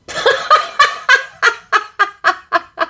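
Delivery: high-pitched burst of laughter. Real, not acted. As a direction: laugh at her own stumble. Rit de son bafouillage. (éclat de rire aigu)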